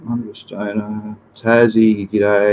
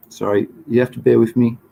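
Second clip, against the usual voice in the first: normal talking voice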